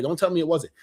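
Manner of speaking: normal talking voice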